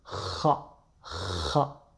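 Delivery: "ra" rasped from deep in the throat like a gargle; throaty Arabic gha. Shown as a demonstration. Teacher demos the guttural gha sound twice. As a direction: scrape "ra" at the back of the throat. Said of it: "il vient du fond de la gorge".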